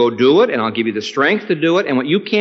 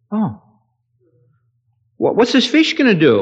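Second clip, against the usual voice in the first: put-on high, loud wondering boy; a child gasping at the idea; preacher voicing the amazed boy; voiced astonished kid; 11 semitones higher, 3 dB louder than usual. Kid voiced in retold story.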